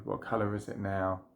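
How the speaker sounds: usual speaking voice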